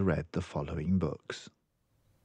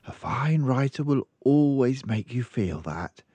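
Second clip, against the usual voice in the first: a touch higher, mildly worried; lightly voiced librarian frowning; concerned librarian; 6 semitones higher than usual. Librarian reassures.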